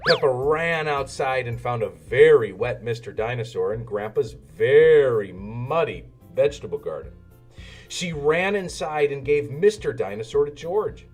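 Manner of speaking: ordinary delivery, nothing marked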